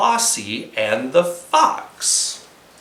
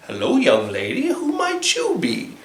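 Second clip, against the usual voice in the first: sly fox voice; 5 semitones higher, 3 dB quieter than usual. Acted. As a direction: charm her like a cunning fox.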